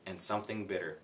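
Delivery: ordinary delivery, nothing marked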